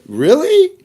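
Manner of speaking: squeaky high mock surprise; hammed-up surprised Really. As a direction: shoot the pitch way up in fake disbelief. China voiced in acted exchange.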